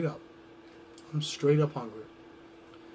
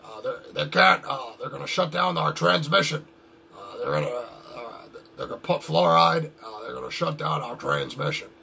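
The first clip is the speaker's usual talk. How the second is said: Alex Jones impression; 5 semitones lower than usual. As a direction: lower conspiracy-radio rant voice, stammering with uh. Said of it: "It's like Alex Jones likes to say".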